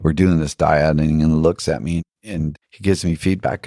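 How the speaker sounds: normal talking voice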